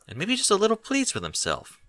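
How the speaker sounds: plain reading voice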